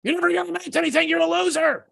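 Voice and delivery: high, fast taunting demon voice; inner demon voice. Retold or imitated. Morning demons taunting him as he wakes, high mocking voice. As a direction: sneer it like a nagging inner voice. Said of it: "those little voices when you wake up".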